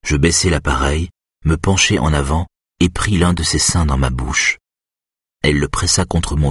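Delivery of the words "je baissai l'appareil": ordinary delivery, nothing marked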